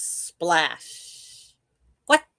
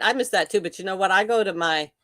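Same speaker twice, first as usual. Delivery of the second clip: ordinary talk, nothing marked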